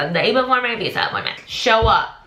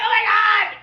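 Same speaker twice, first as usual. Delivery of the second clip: excited disbelief; 12 semitones higher than usual. Retold or imitated. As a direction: shriek it, overjoyed and shocked. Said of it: "I was like".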